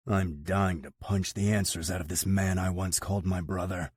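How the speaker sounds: plain reading voice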